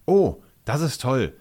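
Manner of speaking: happy little outburst; joyful. A narrator's reading. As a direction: react with delight, leaning on the first word. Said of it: "sagt er freudig".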